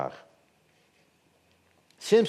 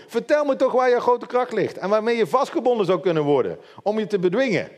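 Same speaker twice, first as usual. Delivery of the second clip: higher-pitched, lightly acted pleading; insistent coaxing question; 7 semitones higher, 4 dB louder than usual. Delilah asks Samson where his strength lies, insistent. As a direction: press him for the secret in a higher voice.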